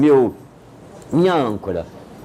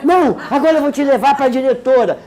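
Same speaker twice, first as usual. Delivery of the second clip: high, laughing mock scold; hammed-up angry teacher; a teacher threatening the boy with the principal, mock hysterical; 9 semitones higher, 4 dB louder than usual. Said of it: "diante daquela histerismo".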